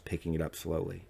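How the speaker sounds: ordinary delivery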